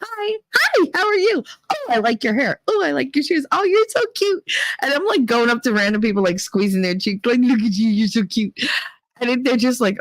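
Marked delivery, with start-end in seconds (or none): 0.0-4.4 much higher, gushing mock-drunk chirp; high, bubbly tipsy cooing; giddy drunk greeting. 7.4-8.6 put-on cooing drunk. Squeezing strangers' cheeks.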